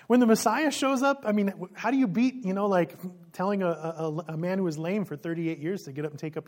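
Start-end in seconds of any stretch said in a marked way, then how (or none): 0.1-2.7 excited. Real, not acted. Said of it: "I love this".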